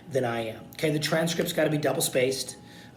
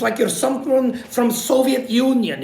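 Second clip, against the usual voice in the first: Russian accent; 8 semitones higher, 7 dB louder than usual. Shown as a demonstration. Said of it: "foreign accents".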